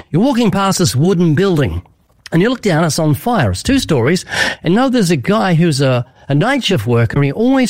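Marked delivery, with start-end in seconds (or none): none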